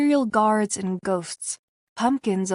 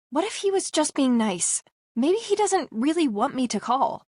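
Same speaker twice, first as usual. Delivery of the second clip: put-on lightly higher young woman, doubtful; a hint of a worried girl, a bit higher; Rayleigh voiced; 6 semitones higher than usual. Rayleigh unsure to Paul whether to call him, lightly higher young woman.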